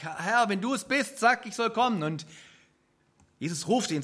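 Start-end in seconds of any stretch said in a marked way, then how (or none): 0.0-2.0 Peter calls across the water, higher and louder; calling out. Acted. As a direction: call out boldly to Jesus. (Petrus ruft laut über das Wasser)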